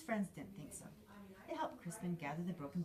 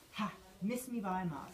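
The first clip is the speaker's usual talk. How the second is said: louder, gleeful taunt; squealing; 2 semitones higher, 7 dB louder than usual. A narrator's reading. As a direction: squeal with playful triumph. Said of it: "squealed Crispin".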